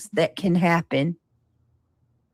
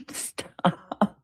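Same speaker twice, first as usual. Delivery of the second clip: laughing; 6 dB quieter than usual. Real, not acted. Laughing while telling off a chatter.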